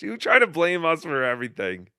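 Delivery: ordinary delivery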